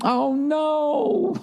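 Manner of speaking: high light mock wife voice; laughing wife. Wife hitting a snag with the setup, high light mock. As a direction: chirp it like his wife laughing it off. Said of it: "laughed and went".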